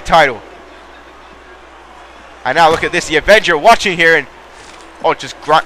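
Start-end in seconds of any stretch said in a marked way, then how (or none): none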